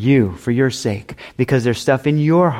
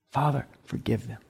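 hushed prayer; 2 semitones lower, 11 dB quieter than usual. Acted. Jesus' words repeated softly.

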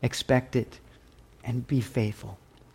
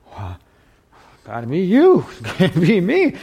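laughing disciples; 3 semitones higher than usual. Retold or imitated. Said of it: "they were like".

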